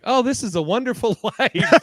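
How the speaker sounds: high, bright hammed-up delight; mock cheerful sitcom voice. Mocking old sitcom characters.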